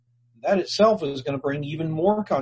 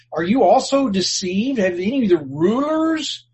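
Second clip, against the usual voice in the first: higher, indignant rebuke; mock-scornful religious leaders; scornful Pharisees; 6 semitones higher, 3 dB louder than usual. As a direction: scold like a haughty ruler. Pharisees scolding the soldiers.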